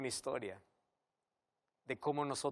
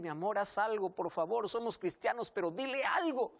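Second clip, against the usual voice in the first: esposa suplicando; 6 semitones higher, 3 dB louder than usual. Acted. Esposa ruega al marido.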